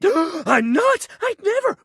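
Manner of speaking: high, gasping protest; wounded jock protests; shocked denial. Acted. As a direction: gasp and insist you'd never.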